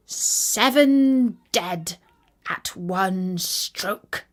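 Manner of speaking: very slow, spelled-out proclamation; slow proud reading. Acted. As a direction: savour each word of the boast. Reading out the belt lettering.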